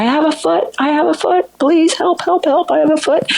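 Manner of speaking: high-pitched panicked mock scream; retold scream. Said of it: "She started screaming".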